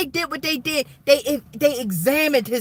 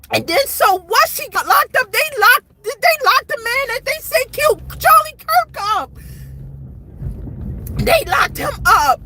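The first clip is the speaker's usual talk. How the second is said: high-pitched, worked-up rant; heated and upset outburst; real exasperation; 8 semitones higher than usual. Real, not acted. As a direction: protest in disbelief, pitch leaping up. Frustrated rant about what people believe.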